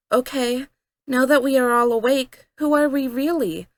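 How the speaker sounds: rally the group with a question, lightly voiced; asking everyone. A narrator's reading. Rose asking the other animatronics their real names, lightly voiced girl. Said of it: "I asked everyone".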